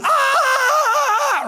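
long, high, sustained "aah"; hammed-up scream of someone plunging off a cliff; loud pretend wail while falling; mock falling scream. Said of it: "I'm gonna also learn loud noises".

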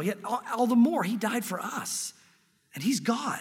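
ordinary talk, nothing marked

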